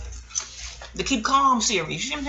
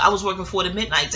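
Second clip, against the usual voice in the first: normal talking voice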